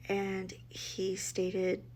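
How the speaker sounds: ordinary talk, nothing marked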